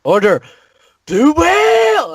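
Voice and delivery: drawn-out, soaring high call on blue whale; dramatic proclamation. Pretend. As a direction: stretch whale out like a hero's battle cry. Announcing his order's name.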